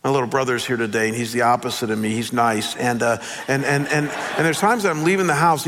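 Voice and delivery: normal talking voice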